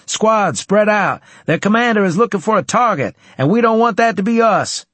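high, loud, urgent commands; shouted orders. Acted. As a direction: shout them apart fast. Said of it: "I ordered".

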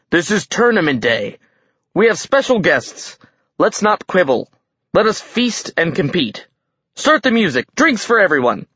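high-pitched, hearty host's announcement; rally the hall to feast; festive proclamation. A narrator's reading. Giant king opening tournament day, festive proclamation.

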